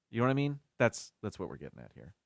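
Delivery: ordinary talk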